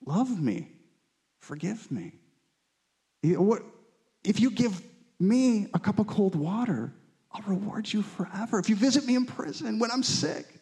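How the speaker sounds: tender voice of Jesus. Retold or imitated. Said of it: "he's like".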